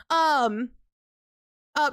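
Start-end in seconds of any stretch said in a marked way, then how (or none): none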